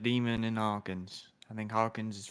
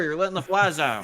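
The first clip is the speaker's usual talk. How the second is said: raised, higher voice; mock shout; 6 semitones higher, 6 dB louder than usual. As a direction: call it out across the room. Player shouts a joke at Hawkins.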